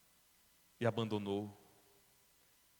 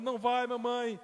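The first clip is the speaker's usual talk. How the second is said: put-on crying child; 10 semitones higher than usual. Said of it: "as crianças chorando".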